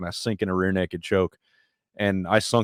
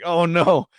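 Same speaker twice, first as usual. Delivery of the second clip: mock dread; 10 semitones higher, 5 dB louder than usual. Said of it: "And I'm like".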